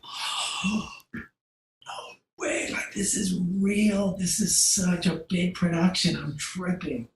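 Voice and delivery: hushed, stunned disbelief; awed whisper. Retold or imitated. Photographer seeing the huge crew, hushed and stunned. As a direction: breathe it out in awe.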